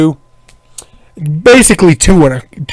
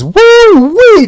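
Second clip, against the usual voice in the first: slow, high woo-wee; high whoop. Real, not acted. Boasting about his night.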